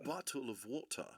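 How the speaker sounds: high English accent, quiet bottle of water. Shown as a demonstration. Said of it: "if I was doing my high English".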